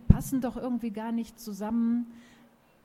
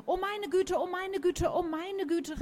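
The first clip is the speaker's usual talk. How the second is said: high, flustered bird voice; a startled dodo calling out high; panicky bird calling; 10 semitones higher than usual. A narrator's reading. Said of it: "rief der Vogel". (hohe, aufgeregte Vogelstimme)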